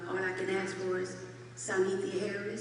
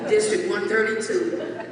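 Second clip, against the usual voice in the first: laughing; 2 semitones higher, 5 dB louder than usual. Real, not acted. Laughing while announcing district.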